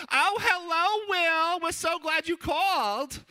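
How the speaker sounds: very high, loud delighted mom voice; gushing mom. Retold or imitated. Mom answering her son's call, gushing in a high mock voice. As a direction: squeal a phone greeting, stretching the last words. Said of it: "my mom answers the phone".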